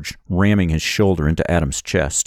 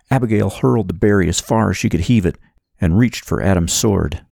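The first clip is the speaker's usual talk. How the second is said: ordinary delivery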